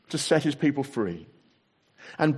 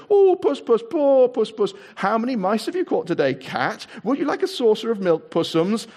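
much higher, louder mock baby talk; jeering; 9 semitones higher, 5 dB louder than usual. A narrator's reading. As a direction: coo at him like a pet to mock him. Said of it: "jeering at him".

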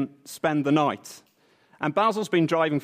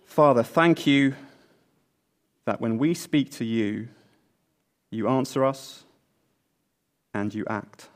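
prayer; 5 semitones lower than usual. Real, not acted. Opening prayer before sermon.

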